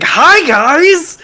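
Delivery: very high, loud grinning hello; overjoyed greeting. Retold or imitated. GM imitating Lami greeting friends, very high loud cheer. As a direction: shout the hello like a rescue. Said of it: "Lami being like".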